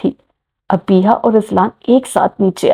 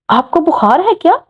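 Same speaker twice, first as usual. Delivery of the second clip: higher, astonished disbelief; surprised question; 8 semitones higher than usual. Acted. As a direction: ask as if he must be ill. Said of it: "Anabia ने हैरत से पूछा".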